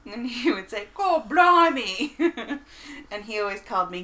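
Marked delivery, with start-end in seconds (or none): 0.9-2.1 slow, loud, higher Cockney exclamation; Cockney stepdad. Retold or imitated. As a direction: stretch out a Cockney blimey. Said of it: "very Cockney accent".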